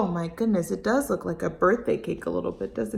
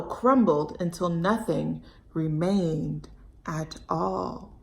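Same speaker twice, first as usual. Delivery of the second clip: put-on expressive read-aloud for kids; storybook reading; 3 semitones higher than usual. A narrator's reading. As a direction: storytime narration. Reads story to children.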